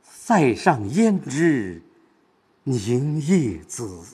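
very slow, 凝夜紫 drawn out after a pause; inward stress on 凝夜紫. Shown as a demonstration. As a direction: weigh down 凝夜紫, turned inward. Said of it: "它是往心里走".

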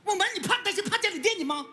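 wounded husband hollering in self-defence; indignant yelling. Acted. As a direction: yell your grievance back at your wife. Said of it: "喊，喊啥呀？".

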